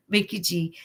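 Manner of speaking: ordinary talk, nothing marked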